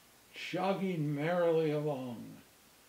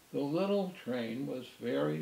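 ordinary delivery, nothing marked

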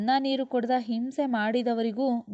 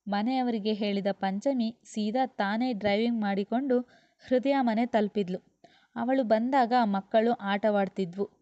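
ordinary delivery

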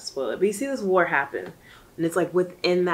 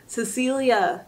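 higher, louder urgent plea to a friend; urgent pep talk; 5 semitones higher, 4 dB louder than usual. Pretend. Pretending to shake book heroine Cecilia, urgent and higher. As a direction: grab her and get her out.

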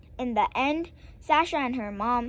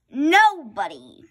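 sulky; 8 dB louder than usual. A narrator's reading. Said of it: "And I said".

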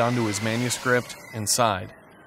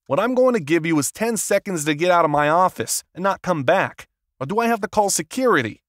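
angry; 5 semitones higher, 4 dB louder than usual. A narrator's reading. Agent threatens to call security.

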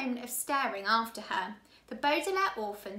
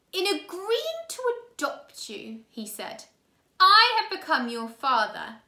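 loud, scratchy menacing villain voice; angry villain; 3 semitones higher, 6 dB louder than usual. A narrator's reading. Count Olaf angrily laying down the law to the orphans. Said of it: "eyebrow raised in anger".

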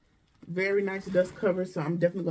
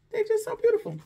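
gushing; 3 dB louder than usual. Real, not acted. Gushing over bag.

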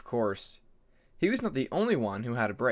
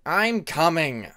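louder, slow snap; irritated shout; 4 semitones higher, 8 dB louder, slower than usual. A narrator's reading. Cliff answering Jaguar's nagging, irritated shout. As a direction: call back, fed up. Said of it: "Cliff snapped back".

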